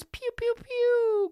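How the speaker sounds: high, playful "pew, pew, pew" like a toy laser gun; mimicking the new coach's gun noises, high and playful; mock gun noises. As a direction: squeak out "pew" sounds like a kid playing soldier. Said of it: "shooting gun noises".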